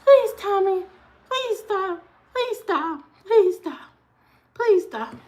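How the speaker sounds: high, pleading mom voice; a high, tired mother begging her son. Retold or imitated. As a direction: beg the kid over and over. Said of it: "She begging him to stop".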